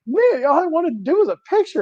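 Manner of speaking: high, whiny mock complaint; hurt protest. Imitating friend whining to a waiter, high hurt protest. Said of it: "he was like".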